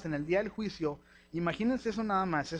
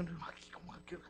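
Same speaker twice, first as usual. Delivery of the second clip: breathless whispered gasp of alarm; startled whisper; 14 dB quieter, slower than usual. Retold or imitated. Pastor reacting to a dark thought about his children, alarmed whisper. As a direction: whisper it as if a dark thought just struck you.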